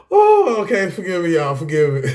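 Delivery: recovering from a real giggle fit; laughing at himself. Real, not acted. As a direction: apologize through leftover laughter. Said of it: "I'm laughing at myself".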